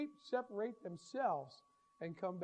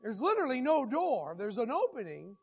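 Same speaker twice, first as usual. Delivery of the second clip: ordinary talk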